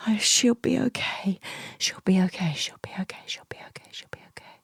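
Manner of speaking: whispered mantra fading to nothing. Retold or imitated. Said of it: "a mantra".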